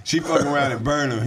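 normal talking voice

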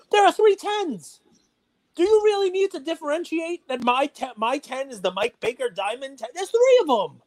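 high, heated rant, voice climbing; real irritation boiling over into a rant; getting angry. Real, not acted. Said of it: "I'm getting angry".

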